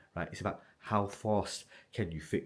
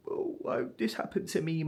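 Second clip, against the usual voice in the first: mock crying whine; 10 semitones higher than usual. Mimicking a moping crying kid.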